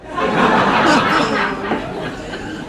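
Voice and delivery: a loud, full laugh at the joke; hearty laugh. Acted. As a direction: burst out laughing at the doctor. McGee laughs at doctor's joke.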